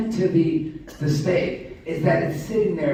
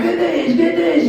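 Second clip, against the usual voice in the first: pretend calm rider; 9 semitones higher, 5 dB louder than usual. Imagining calm rider before drop.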